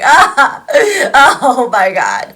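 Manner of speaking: loud hammed-up wail breaking into laughter; mock anguished wail. Wife mocking the idea of her logical father-in-law distraught, mock wail. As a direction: cry out in fake despair, laughing. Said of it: "slamming his fist and being like".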